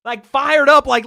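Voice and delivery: ordinary talk, nothing marked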